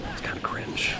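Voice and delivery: breathy unvoiced whisper; hushed gossip whisper; whispered aside. Mock whisper about Wario.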